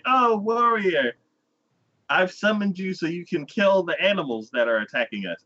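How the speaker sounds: hammed-up damsel-style appeal; pleading summoner. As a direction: implore a hero theatrically. Villagers summon the hero, acted.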